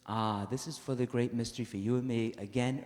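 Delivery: ordinary delivery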